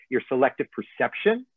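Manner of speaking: ordinary talk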